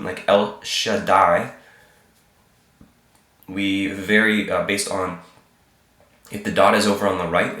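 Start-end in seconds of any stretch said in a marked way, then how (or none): none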